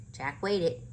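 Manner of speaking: usual speaking voice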